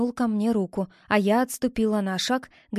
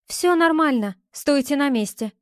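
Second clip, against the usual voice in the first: higher, louder call; 5 semitones higher, 4 dB louder than usual. A narrator's reading. Woman telling approaching friends to stay back, calling out. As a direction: call out firmly, pitch raised. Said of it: "крикнула я парням".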